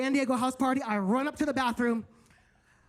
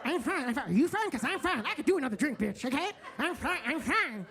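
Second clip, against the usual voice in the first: a tipsy friend insisting she's fine, drifting higher; drunk insisting fine; 3 semitones higher than usual. Retold or imitated. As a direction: slur out a drunk denial, dragging the last one.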